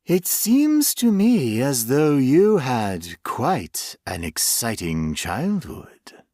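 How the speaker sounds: slow, lightly voiced monster savoring its words; a hint of a sinister creature reminiscing; menacing creature. Creature speaking to its captive.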